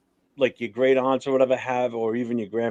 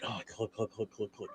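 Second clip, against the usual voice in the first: muttered clicking; 3 semitones lower, 18 dB quieter than usual. Retold or imitated. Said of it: "So you'd be like".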